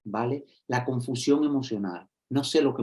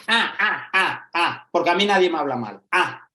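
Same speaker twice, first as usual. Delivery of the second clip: higher, louder scoffing protest; indignant teen; 5 semitones higher, 5 dB louder than usual. Retold or imitated. A student telling the teacher nobody speaks to him badly, loud and indignant. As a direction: scoff and bristle like an offended kid. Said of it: "me dice".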